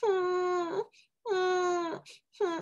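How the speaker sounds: little sad whimpers, pitched high; high whimpering hums. Shown as a demonstration. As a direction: whimper like a small sad llama. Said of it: "Can you whimper with me".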